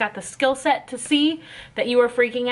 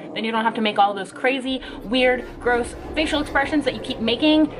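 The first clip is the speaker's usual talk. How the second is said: normal talking voice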